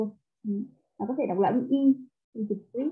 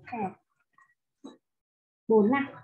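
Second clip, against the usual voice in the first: ordinary talk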